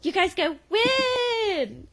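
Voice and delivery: very high, drawn-out cheer; Jo's cheer. Retold or imitated. Mom teaching girls the chant, high and eager. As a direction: show them an all-out team shout. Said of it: "And she goes".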